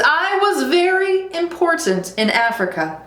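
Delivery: high, self-important cub voice; boastful lion cub. Acted. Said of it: "said the lion cub".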